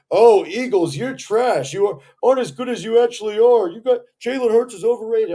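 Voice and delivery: loud, high-pitched trash-talking fan imitation; mocking rival fans. Eagles fan quoting Dallas fans' trash talk, mocking. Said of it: "And you're freaking saying".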